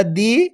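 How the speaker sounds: दी held long on a rising tone; दी, long and sustained; drawn-out long vowel. Shown as a demonstration. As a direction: stretch the ee of दी upward. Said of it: "खींच के पढ़ा जाता है".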